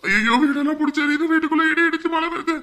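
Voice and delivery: high, loud frantic cries; panicked shouting. Acted. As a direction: yell for help as the house burns. Victim panicking, house burning. (பதற்றத்தில் உரத்த கூக்குரல்)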